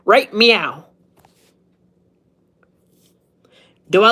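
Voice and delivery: hammed-up cop interrogation over a cat pun; Super Troopers cop. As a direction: grill the listener like an offended highway cop. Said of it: "Super Troopers".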